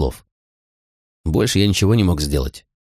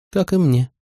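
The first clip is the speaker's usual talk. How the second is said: short, higher-pitched female character reply; understated curtness in a higher voice; curt reply; 9 semitones higher than usual. A narrator's reading. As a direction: answer briefly, voice lifted. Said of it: "коротко сказала Молли".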